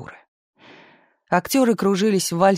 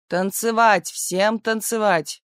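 higher, drawn-out order to dance; commanding; 4 semitones higher, slower than usual. A narrator's reading. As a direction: command the room to dance, slow and lifted. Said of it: "приказал Арсеньев".

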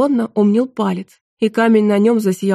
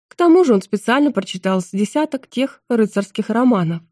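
ordinary delivery

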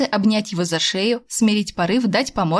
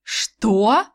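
angry; 2 semitones higher than usual. A narrator's reading. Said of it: "Зло спросила".

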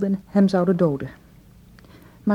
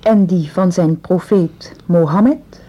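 slow solemn; 4 dB louder, slower than usual. Acted. Dictating the oath.

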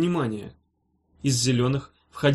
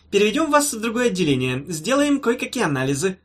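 high-pitched doctor's patter; officious, pitched-up professor; breezy professor; 6 semitones higher than usual. A narrator's reading. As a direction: lay out the plan airily.

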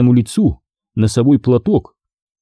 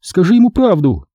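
a higher, urgent "tell him, Alan"; fervent plea; 8 semitones higher, 4 dB louder than usual. A narrator's reading. Pat urging Alan to tell the truth, fervently pleading. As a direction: press him earnestly. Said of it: "горячо попросила она".

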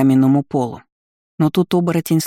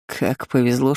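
plain reading voice

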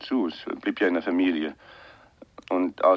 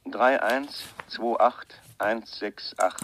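slow dictation; much slower than usual. Acted. Dictating a phone number slowly.

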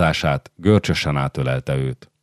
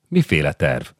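ordinary delivery

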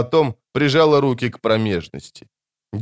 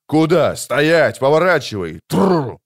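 raised voice turning back deserters; shouted orders; 4 semitones higher, 3 dB louder, slower than usual. A narrator's reading. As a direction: shout each command separately. Witcher shouting at fleeing soldiers. (командные окрики беглецам)

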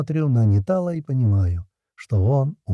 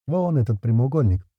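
plain reading voice

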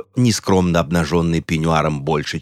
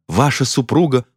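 higher, alarmed exclamation; startled, dramatic cry; 6 semitones higher than usual. A narrator's reading. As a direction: exclaim in theatrical shock. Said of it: "вскричала она".

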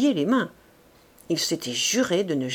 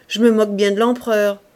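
louder scornful "Je me moque bien de l'empereur", stress on "me"; quoting a man's loud, scornful dismissal of the emperor; defiant retort; 7 dB louder, faster than usual. Retold or imitated. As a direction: throw out the insult to the emperor with contempt. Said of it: "ce malheureux a répondu".

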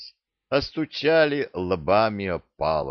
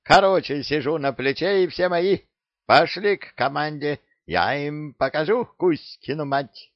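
cocky parrot; 8 semitones higher, 3 dB louder than usual. Acted. Parrot boasts, high and loud.

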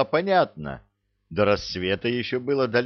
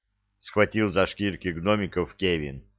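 ordinary talk

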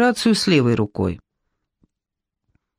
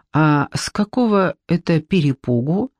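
ordinary delivery, nothing marked

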